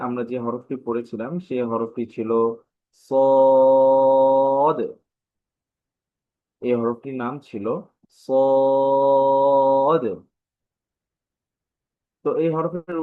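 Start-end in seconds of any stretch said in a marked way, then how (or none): 3.1-4.8 long, loud held vowel on "সদ"; "সদ" intoned loud and very long; drawn-out letter name. Shown as a demonstration. Teacher models the letter name. 8.2-10.0 long, loud held vowel on "সদ"; "সদ" intoned loud and very long; drawn-out letter name. Shown as a demonstration. Teacher repeats the letter name.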